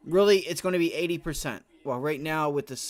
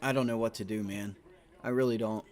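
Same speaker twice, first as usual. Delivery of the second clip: defeated; 7 semitones lower than usual. Real, not acted. Defeated after missed trade.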